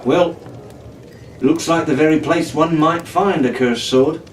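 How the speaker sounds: usual speaking voice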